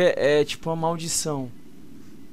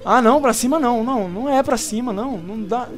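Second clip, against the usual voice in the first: panicking; 9 semitones higher than usual. Real, not acted. Panicking as game goes wrong.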